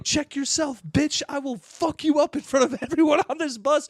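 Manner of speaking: high-pitched threat without full volume; mock yelling threat. Man threatening woman on the bus, mock yelling. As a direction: imitate a furious man, high and half-amused. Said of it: "They keep yelling at each other".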